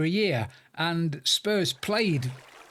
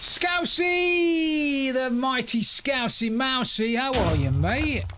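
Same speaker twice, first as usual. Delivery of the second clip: big high drawn-out shouted welcome; hailing shout; 8 semitones higher, 3 dB louder, much slower than usual. Real, not acted. Streamer greets a raiding friend, high hailing shout. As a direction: holler the name long and high, like announcing a champion. Hailing Scousey arriving with raid.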